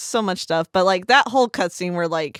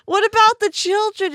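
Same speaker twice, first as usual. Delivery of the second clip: very high mock-indignant question; acted appalled plea; 14 semitones higher, 4 dB louder than usual. Retelling own protest to the friend, appalled plea. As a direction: plead it high, as if scandalized.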